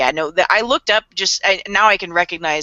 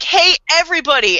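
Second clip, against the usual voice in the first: higher, mock show-off shout-out; mock a show-off hailing the crowd; attention-seeking call; 8 semitones higher, 4 dB louder than usual. Serial killer announcing himself to the world, mock show-off call.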